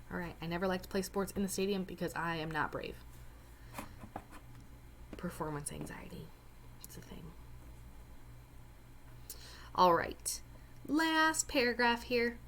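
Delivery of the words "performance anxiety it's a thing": whispered aside. Real, not acted. Joking aside about own nerves.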